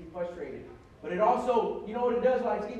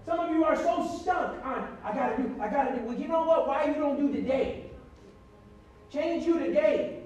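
louder, higher preacher's cry; rally the church; preaching at full force; 5 semitones higher, 7 dB louder than usual. Acted. Preaching climax about today's word.

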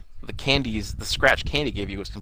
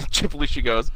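ordinary talk, nothing marked

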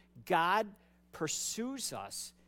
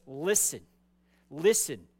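loud, lowered, commanding; demonstrative; 3 semitones lower, 7 dB louder than usual. Acted. God confronting Cain after the killing, loudly demonstrative. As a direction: punch each word to demand attention. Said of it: "It's very demonstrative".

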